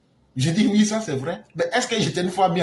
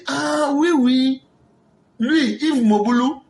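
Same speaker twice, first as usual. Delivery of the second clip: mock realization; 7 semitones higher, slower than usual. Feigned realization.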